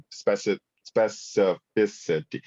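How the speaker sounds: slowly working out "specificity" syllable by syllable; stumbling over a word. Real, not acted. Speaker stumbles over "specificity" and slowly sounds it out. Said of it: "I am struggling with that word".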